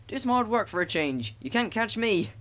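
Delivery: high-pitched teasing taunt; cheeky. A narrator's reading. Thomas taunting tired Gordon, cheeky and high. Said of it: "in his cheeky way".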